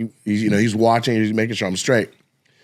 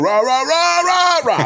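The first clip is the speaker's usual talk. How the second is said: crowd roar; 12 semitones higher than usual. Retold or imitated. Comic imitating the crowd's roar for his friend, high. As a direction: chant like a roaring crowd. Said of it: "the crowd is going".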